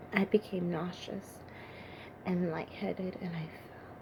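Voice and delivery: fading into a soft whisper; faint, sickened. Acted. David reeling after the killing, fading to a whisper. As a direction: let the voice drain away to almost nothing.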